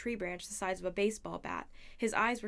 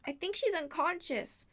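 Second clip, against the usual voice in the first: high, slow, drawn-out call; yelled from above; 9 semitones higher, 4 dB louder than usual. A narrator's reading. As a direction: call it down to friends. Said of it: "Grover yelled somewhere above us".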